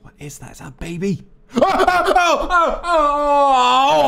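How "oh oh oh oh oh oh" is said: high shrieking oh's; startled shriek, much higher. Real, not acted. Jump scare then relief laugh.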